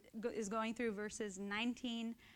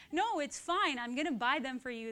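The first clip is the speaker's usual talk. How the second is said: higher, louder, hurried brother giving in; hammed-up brother caving, fast; imitating brother; 4 semitones higher, 7 dB louder than usual. Brother caves in retold story.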